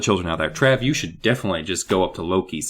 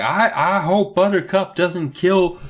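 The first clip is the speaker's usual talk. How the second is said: worried; 8 semitones higher, slower than usual. Acted. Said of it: "Molly's worried".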